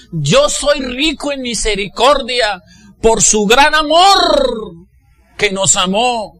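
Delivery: high, loud, impassioned preaching; rousing sermon cry, pitched high; preaching at full force. Real, not acted. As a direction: urge the congregation at full voice.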